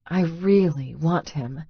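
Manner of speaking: normal talking voice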